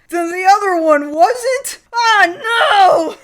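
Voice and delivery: dismayed realization. Retold or imitated. Ryo realizing his mistake, high dismayed cry. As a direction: realize it in mock horror. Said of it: "Ryo realizes".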